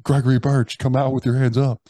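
imitated amplified order to surrender; police bullhorn imitation. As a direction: call out like an officer on a bullhorn. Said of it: "on bullhorns, and they're like".

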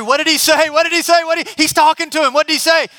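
high, loud, urgent repeated question; excited puzzlement. Acted. Friends on the roof asking each other, high and urgent. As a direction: pester the others to find out.

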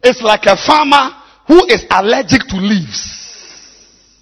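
high, loud, slow preaching shout; preaching at full force. Real, not acted. Pastor rebuking leaders without Bibles, full-force preaching. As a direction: thunder it from the pulpit.

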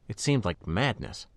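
usual speaking voice

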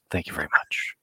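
whispered sign-off. Pretend. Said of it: "my best pilot's voice here".

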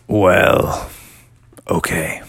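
low, grudging guardian voice; deep, halting menace; scary voice. Acted. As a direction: agree reluctantly in a deep spooky voice. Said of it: "your voice is pretty scary".

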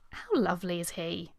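ordinary talk

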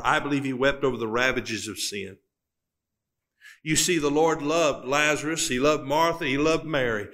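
ordinary talk, nothing marked

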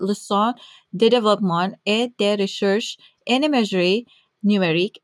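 careful, non-native French pronunciation; bad French accent. Real, not acted. Host attempting a Quebec institute's name, bad French accent. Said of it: "Please forgive my bad accent".

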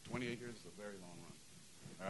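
soft, slow and choked up; getting emotional. Real, not acted. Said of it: "I'm gonna get all emotional now".

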